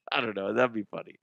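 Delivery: ordinary delivery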